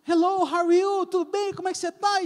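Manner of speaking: lightly imitated eager youths saying hello; eager greeting. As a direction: mimic cheerful strangers.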